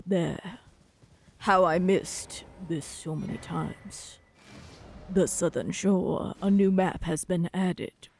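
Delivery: low and dark like a mad scientist; deep voice. Acted. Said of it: "my deep voice".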